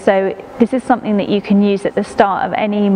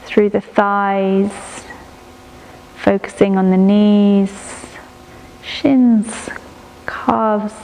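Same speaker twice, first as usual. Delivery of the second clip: guided meditation. Acted. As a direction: ease the listener into stillness, breath by breath. Leads a prenatal body-scan meditation.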